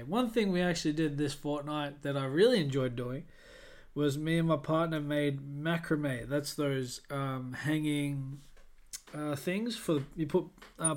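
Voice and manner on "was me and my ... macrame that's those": ordinary talk, nothing marked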